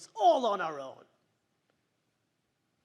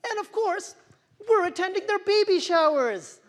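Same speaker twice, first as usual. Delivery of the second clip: loud, high, laughing exaggeration; loud projection; 10 semitones higher, 10 dB louder than usual. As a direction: project it big with a mock laugh. Speech, mocking fantasy of fertile friends.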